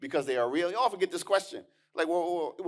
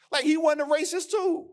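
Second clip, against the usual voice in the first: sarcastic mock; 11 semitones higher, 5 dB louder than usual.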